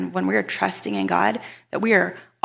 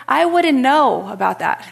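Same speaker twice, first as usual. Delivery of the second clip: higher, louder retold dig; mock pointed reply; 5 semitones higher, 6 dB louder than usual. Neighbor never offered any oranges, mock-pointed raised reply.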